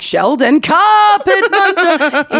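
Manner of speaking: high, drawn-out holler; mock heckler call. Comedian imitates drunk Sheldon heckling mid-song, mock heckler call. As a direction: stretch it out like a heckler calling across a room.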